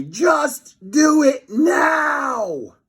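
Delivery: high, loud, slow screaming cry. A narrator's reading. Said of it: "screamed Fluffy".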